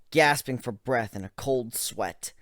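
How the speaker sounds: ordinary delivery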